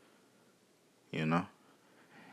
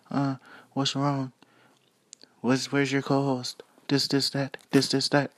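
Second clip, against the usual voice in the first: mock listener voice; 8 semitones higher than usual. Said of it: "as many people may be wondering".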